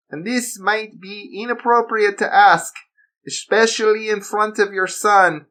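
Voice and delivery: high, mock-polite German villain; sly and falsely courteous in German accent. Villain asking where the boy is, German accent. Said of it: "He spoke in a German accent".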